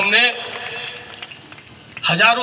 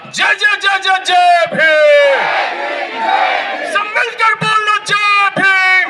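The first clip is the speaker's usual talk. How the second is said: shouted slogan; 10 semitones higher, slower than usual. Acted. Speaker closes the rally leading the crowd, shouted chant. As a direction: rouse the crowd to answer each call.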